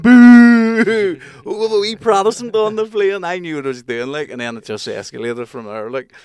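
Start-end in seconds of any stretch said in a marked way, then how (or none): 0.0-1.2 long, high, very loud boo; drawn-out jeer, belted out; crowd booing. Retold or imitated. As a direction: boo like thirty passengers at once. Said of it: "about thirty people just went".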